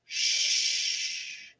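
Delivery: "Sh" as a long hushing sound. Shown as a demonstration. Teacher showing children the sh sound. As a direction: hiss out a soft "Sh" with no voice. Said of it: "what those two letters say".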